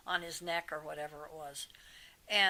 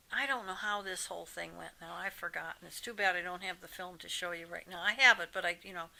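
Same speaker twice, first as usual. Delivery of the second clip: usual speaking voice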